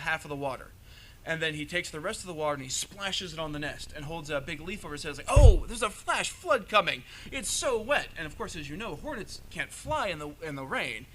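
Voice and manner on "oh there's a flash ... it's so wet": loud, slow, hammed-up alarm; mock flood alarm. Anansi fakes panic to fool the hornets, loud and hammed-up. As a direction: cry out a fake warning, much higher.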